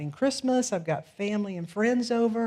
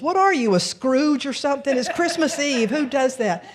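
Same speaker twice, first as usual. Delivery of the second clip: loud, high, scornful heckle; mocking shout; 6 semitones higher, 7 dB louder than usual. A driver jeering at the stopped woman, loud mocking shout. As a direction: jeer at the rude driver. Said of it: "what I heard coming up is".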